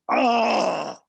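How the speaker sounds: loud, high acted "oh" of dread; big retold groan; dismayed groan. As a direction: groan out loud at bad news. Told he needs covid test.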